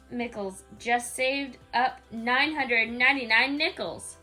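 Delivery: ordinary delivery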